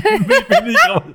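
high laughing reply. Real, not acted. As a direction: laugh through the words. Admits name unsure.

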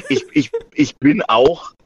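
ordinary talk, nothing marked

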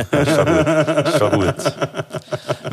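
slow, laughing reassurance; real laughter while forgiving a slip. Real, not acted. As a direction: laugh through it, brushing it off. Laughs off colleague's stumble.

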